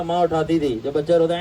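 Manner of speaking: usual speaking voice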